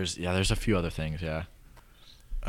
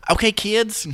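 coach voice; 10 dB louder, slower than usual. Retold or imitated. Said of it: "kids' class".

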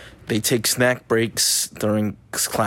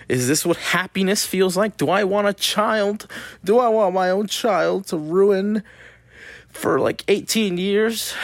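high, slowed mock-tearful questions; melodramatic, pitched-up wondering; mock emotional; 11 semitones higher, 4 dB louder than usual. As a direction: ham up a sudden baby craving. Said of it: "I'm feeling emotional".

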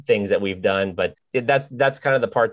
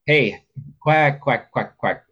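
light, lilting quacks; greeting quacks; 5 semitones higher, much slower than usual. Shown as a demonstration. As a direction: bounce the quacks gently like a greeting. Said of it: "that's kind of a more of a greeting".

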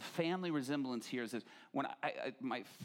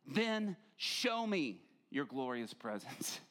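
slow reverent reading; much slower than usual. Acted.

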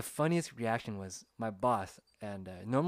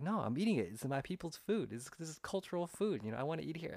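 higher-pitched, insistent objection; mock protest; 6 semitones higher than usual. Defending his people's food to coworker, mock protest. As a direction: push back in a raised pitch.

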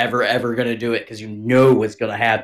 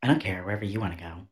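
soft indifferent wife; 4 semitones lower, 11 dB quieter than usual. Retold or imitated. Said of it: "She usually says".

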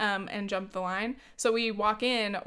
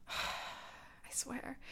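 exasperated sigh; 3 semitones higher, 14 dB quieter than usual. Real, not acted. Exasperated sigh about selfish elders.

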